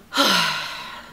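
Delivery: long, heavy exhale; exasperated sigh. Real, not acted. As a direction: let out a drawn-out sigh. Sighs after recounting the stress.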